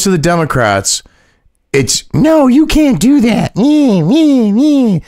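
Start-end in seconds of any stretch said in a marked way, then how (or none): 2.1-5.0 very high, slow whiny caricature; mock whining. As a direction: wail it like a mocked tantrum, stretching each word. Mocks Democrats complaining.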